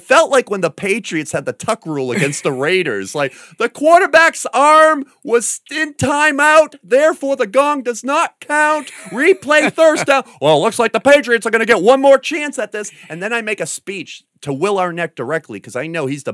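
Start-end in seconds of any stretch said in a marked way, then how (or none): 3.6-10.2 high, loud, slow official ruling; hammed-up ref announcement; mock referee call. 10.4-12.9 high, loud TV broadcaster voice; hammed-up football announcer; mock sports commentator.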